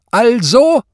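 high, very loud demand; explosive shout from the bench; sudden bellow. A narrator's reading. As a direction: roar the single word. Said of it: "um dann zu brüllen".

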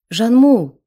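high, loud crying call; weeping cry. A narrator's reading. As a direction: wail his name through tears. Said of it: "плакала женщина".

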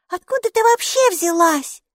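put-on high, bright child's voice, chattering; a cheerful little girl greeting her strange friend; little girl Maiki. Майки, маленькая девочка, радостно болтает с Букой.

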